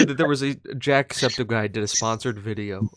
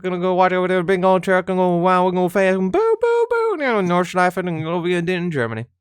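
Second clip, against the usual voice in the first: loud, higher goofy sat-nav rant; hammy sat-nav; 5 semitones higher, 7 dB louder than usual. Pretend. Voice vet hamming up sat-nav directions, loud and manic. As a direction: whoop through the route like a manic sat-nav. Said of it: "what would it be like".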